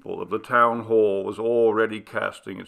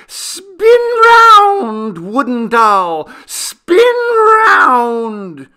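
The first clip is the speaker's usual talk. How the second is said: much higher and much louder, slow, piercing scream; piercing mad shriek; 14 dB louder, much slower than usual. Acted. Nathanael on the tower, shrieking at Clara in madness. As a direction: shriek it like a raving madman. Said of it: "he shrieked out in a piercing tone".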